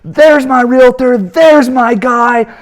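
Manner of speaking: high, loud and slow proud greeting; booming welcome. Retold or imitated. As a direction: draw it out like a host announcing a guest. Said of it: "He comes walking down and he goes".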